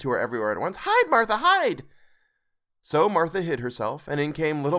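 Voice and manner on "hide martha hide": put-on much higher, louder urgent kids; excited children. A narrator's reading. Young Cratchits warning Martha, much higher excited cry. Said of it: "cried the two young Cratchits".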